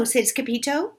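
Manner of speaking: ordinary delivery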